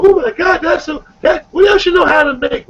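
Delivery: high, mock-swooning delight over food; impressed date. Date tasting his cooking and swooning, high impressed gush.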